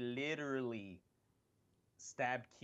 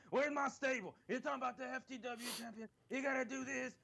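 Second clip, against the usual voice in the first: high, fast, demanding whine; Taz impression; 12 semitones higher than usual. Imitating Taz demanding his stable, high whiny rant. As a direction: complain like a disgruntled wrestler. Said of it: "He's all like".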